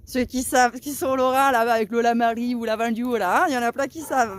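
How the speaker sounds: loud, broad rural Lorraine accent; demonstrating her old Lorraine farm-girl accent; Lorraine country accent. Shown as a demonstration. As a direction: put on a peasant accent from Lorraine. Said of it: "avec mon accent de paysanne de la Lorraine".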